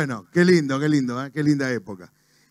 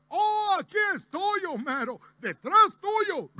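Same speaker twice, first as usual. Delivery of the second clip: Barney; 11 semitones higher, 4 dB quieter than usual. Acted. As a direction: answer in a high, softer wobbly voice. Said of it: "Barney, ¿dónde estás?". (amigote de dibujo animado, muy agudo y arrastrado)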